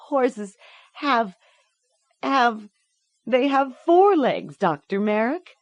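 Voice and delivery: put-on high halting child's voice; faltering child. A narrator's reading. Girl stammers the truth to the doctor, frightened. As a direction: stumble over the words, frightened.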